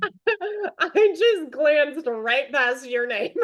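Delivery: slow, laughing through the words; embarrassed laugh while admitting the slip; laughing apology. Real, not acted. Laughing apology for misreading names.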